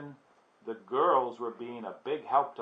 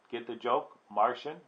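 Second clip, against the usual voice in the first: ordinary delivery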